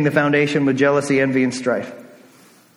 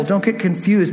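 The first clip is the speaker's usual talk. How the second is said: normal talking voice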